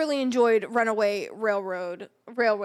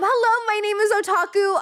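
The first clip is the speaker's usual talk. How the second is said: high cute intro voice; cute anime streamer; 8 semitones higher, 4 dB louder than usual. Acted. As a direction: introduce yourself brightly and high.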